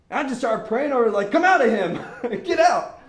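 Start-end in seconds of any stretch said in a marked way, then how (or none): 1.3-3.0 high, loud mock command; commanding exorcism. Teen praying over convulsing boy, commanding shout. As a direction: shout it out, hammed-up.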